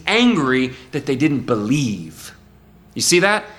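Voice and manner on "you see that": ordinary talk, nothing marked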